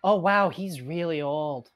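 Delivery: put-on a young girl's drawn-out wonder; Evandra. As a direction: say it high and slow, marvelling. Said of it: "Evandra kinda looks at you ... and goes".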